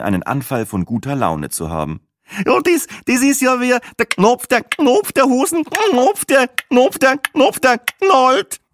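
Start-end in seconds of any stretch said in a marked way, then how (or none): 2.4-8.6 very high squeaky voice; squealing. A narrator's reading. Woman imitates the click language at the table, squealing. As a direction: squeal the words out in excited repeats. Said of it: "Dann quietschte auch der Wetterfloh dazwischen".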